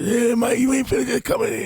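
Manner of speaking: lightly gravelly tough man; rough-voiced country tough guy. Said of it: "he had that gravelly voice".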